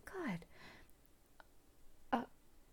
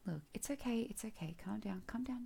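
usual speaking voice

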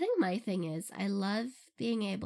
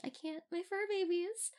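cooing adoration; 9 semitones higher than usual. Acted. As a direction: coo helplessly over adorable pets. Gushing over beloved ferrets.

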